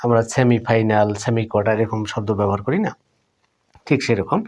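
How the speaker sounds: ordinary talk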